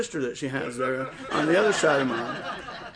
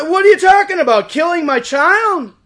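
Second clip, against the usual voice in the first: high, loud, indignant shout; outraged retort; 12 semitones higher, 8 dB louder than usual. Retold or imitated. Father confronting doctors over ending the pregnancy, outraged shout. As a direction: fire it back in appalled disbelief.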